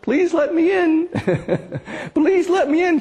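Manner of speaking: high, slow pleading call at the door. Acted. Jesus knocking at the door, calling out pleadingly. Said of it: "he's sort of calling out".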